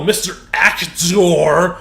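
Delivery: drawn-out sneering "Mister Actor"; sarcastic sneer. Real, not acted. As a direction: say it with scorn, slowly. Sneering nickname for Murray.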